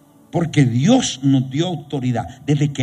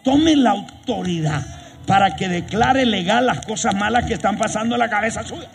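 raised, driving sermon voice; fiery preaching; 5 semitones higher than usual. Acted. Preacher rousing the congregation, fiery preaching. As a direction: preach it out, building.

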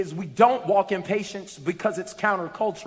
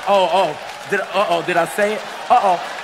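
playful uh-oh; 7 dB louder than usual. Real, not acted. Playful aside after bold remark.

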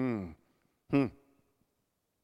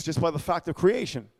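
ordinary delivery

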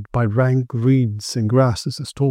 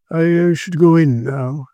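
ordinary delivery

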